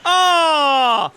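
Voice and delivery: high, loud, slow gleeful shout; excited yelling. Real, not acted. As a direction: yell it out in gleeful shock. Gross kill in game.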